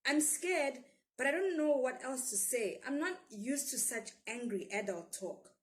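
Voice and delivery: usual speaking voice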